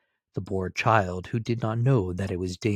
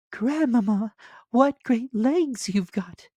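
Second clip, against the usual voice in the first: put-on much higher, louder child voice; little girl voice; 4 dB louder, slower than usual. A narrator's reading. Little girl answering the wolf, high and childlike. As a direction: pipe it up like a small girl.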